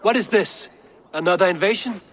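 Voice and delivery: high, incredulous questions; alarmed questioning. Acted. Ruler asks his gods about another invasion, alarmed. Ruler demands news of attack.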